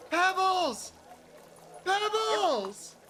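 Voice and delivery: put-on high, loud kid calling out; crying little kid. Kid calls to his rescued kitten, high excited cry. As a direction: cry the name out high like a child. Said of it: "And he goes".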